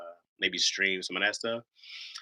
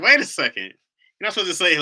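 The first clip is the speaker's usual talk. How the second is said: higher, louder mock indignation; indignant objection; 9 semitones higher, 9 dB louder than usual. Husband objects to his wife's answer, mock indignant. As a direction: act out an affronted interruption.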